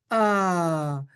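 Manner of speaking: ordinary delivery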